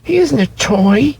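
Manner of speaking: put-on high earnest child, building at "He's real"; little boy insisting. A narrator's reading. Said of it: "he said".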